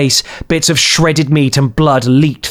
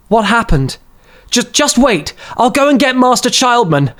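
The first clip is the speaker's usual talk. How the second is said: voiced boy; 11 semitones higher than usual. A narrator's reading.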